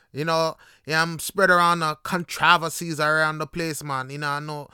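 emphatic Jamaican accent, leaning on "controversies"; Jamaican Patois accent. Shown as a demonstration. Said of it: "is said so differently".

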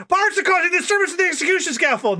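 high, fast, breathless report; frantic soldier bursting through the door; panicked underling. Acted. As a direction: burst in yelling the bad news. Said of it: "underling comes in".

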